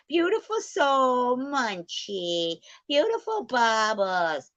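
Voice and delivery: high, slow, sugary mimicry of a fake friend; mocking fake sweet voice. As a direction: parody her gushing compliments in a falsetto. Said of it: "a fake lying bitch who goes".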